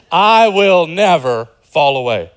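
loud, slow, drawn-out vow; full-voiced, cocksure insistence; arrogant boast. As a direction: declare it boldly, leaning on "I will never". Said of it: "Peter's arrogance, his hubris is, is on full display".

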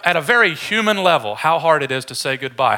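ordinary delivery

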